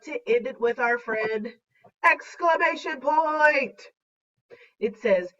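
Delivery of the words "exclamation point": high, drawn-out hype intro; excited announcement. Acted. Teacher introduces the friend Exclamation Point, excited announcement. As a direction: announce it like a star guest.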